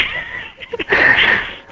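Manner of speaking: high, breathless helpless laugh; real laugh while trying to recover; high laughter. Real, not acted. Guest trying to regain composure, laughs high. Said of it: "Let me just get my composure".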